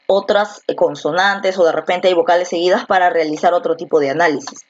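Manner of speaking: usual speaking voice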